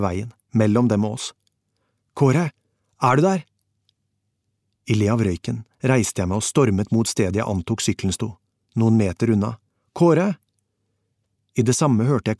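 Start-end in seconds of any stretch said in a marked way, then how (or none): 2.1-3.5 high, searching call; raised-pitch anxious call. A narrator's reading. Calling for friend in smoke. 10.0-10.3 high, urgent call of his name; pitched-up searching cry. A narrator's reading. Calling again for missing friend.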